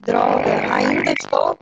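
deep robotic glitching call audio. Real, not acted. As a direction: voice garbled like a robot. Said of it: "Tá, ainda está".

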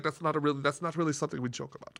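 put-on low, soft deadpan Austrian accent; quiet, dry Austrian rebuke; unbroken matter-of-fact Austrian. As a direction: imitate an Austrian woman, completely straight. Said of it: "without breaking stride, she goes".

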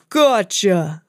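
a hint of a gruff giant; lightly voiced giant. Giant catching Mickey, a lightly voiced gruff cry. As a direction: grab the word like a giant. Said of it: "the giant cried".

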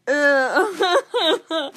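loud, disgusted ugh; disgusted groan. Real, not acted. Candy taster recalling the sour taste, loud disgusted groan.

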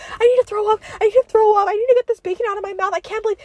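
high, loud, panicked squeal; mock freak-out. Popular girl scraping her tongue, hammed-up panic. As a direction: imitate a frantic girl about to vomit. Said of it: "she was freaking the fuck out".

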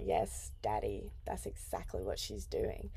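deep, soft mock "Daddy" voice. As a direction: drop way down and murmur it. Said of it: "And I was like".

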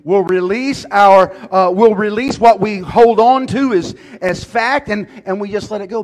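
loud, high, slow, hammered; fiery preacher building to a roar; preaching at full force. Acted. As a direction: thunder it from the pulpit. Preaching climax.

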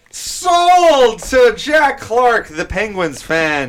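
high, loud, drawn-out auctioneer cry. Pretend. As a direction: belt out the final sale slowly. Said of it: "I'm gonna give 'em a going once".

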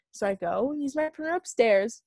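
usual speaking voice

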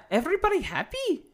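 high, eager put-on clown voice; excited clown voice. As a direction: sell it like a chirpy mascot. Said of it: "But it wasn't so excited".

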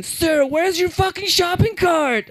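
high, slow mock clerk voice; bossy store clerk. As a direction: bark it like a petty rule enforcer. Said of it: "first stops me again".

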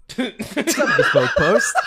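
slow, laughing read of the email; laughing mock greeting. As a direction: savour the silly greeting. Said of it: "I can't believe you opened this up".

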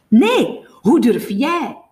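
loud, high, slow comeback; stretched-out, outraged counter-question; angry retort. A narrator's reading. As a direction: throw the accusation back forcefully. Jamie angrily throws the accusation back.

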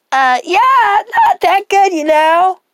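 high, loud whiny customer voice; high squeaky customer. Acted. Customer character voice.

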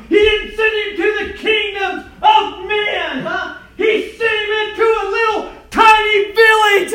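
high, loud shouted preaching; preaching at full force. Real, not acted. Preacher shouting to the congregation about Nazareth.

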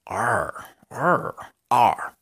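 ARE repeated with a lingering, playful r; flirty growled are. Shown as a demonstration. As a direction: growl ARE as if teasing a crush. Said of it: "haciendo fieros a una chica que les gusta".

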